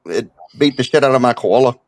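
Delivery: fake Australian accent. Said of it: "That's pretty good, actually".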